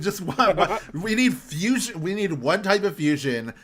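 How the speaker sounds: loud, high-pitched fed-up plea; exasperated, voice pushed up and loud; exasperated rant. Acted. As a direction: rant at the writers in mock outrage. Mock rant at endless power-ups.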